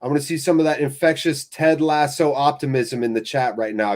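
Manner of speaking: normal talking voice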